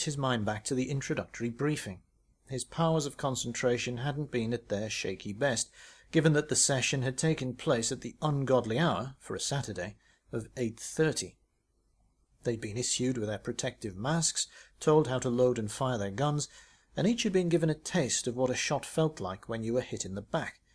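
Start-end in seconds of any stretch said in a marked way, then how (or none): none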